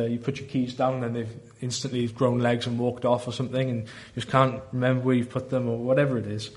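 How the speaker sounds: ordinary talk, nothing marked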